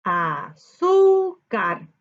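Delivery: "Azúcar" drawn out slowly, syllable by syllable. Shown as a demonstration. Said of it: "Separamos por sílaba azúcar".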